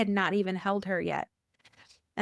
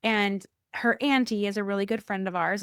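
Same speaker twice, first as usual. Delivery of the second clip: usual speaking voice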